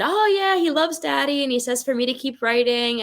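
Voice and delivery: imitating her child self, high and chirpy; little girl. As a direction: sound like a happy small kid passing on a message.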